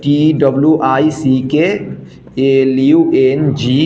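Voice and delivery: letters spelled out slowly, one by one; slow, careful letter-by-letter spelling. Shown as a demonstration. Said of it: "কীরকম?".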